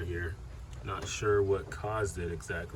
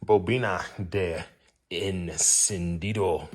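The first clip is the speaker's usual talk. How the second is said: rolled, careful Spanish vowels on bobina de encendido; Spanish accent; 4 dB louder, slower than usual. Shown as a demonstration. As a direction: say bobina de encendido slowly like a native Spanish speaker. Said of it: "You like my Spanish accent".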